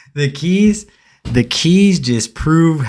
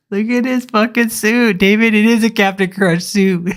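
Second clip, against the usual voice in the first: laughing disbelief; 9 semitones higher than usual. Real, not acted. Laughing at the preacher's suit.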